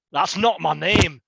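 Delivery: slow, mildly forceful Dwarden retort; lightly voiced Dwarden. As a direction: draw the retort out, a touch forceful. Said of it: "And, and the Dwarden, like, grips".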